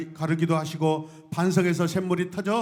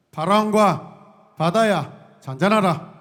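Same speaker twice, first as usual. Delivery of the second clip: slow, loud rebuke to the storm; booming command to wind and sea; 8 dB louder, much slower than usual. Acted. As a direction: proclaim it slowly and grandly. Pastor voices Jesus commanding storm.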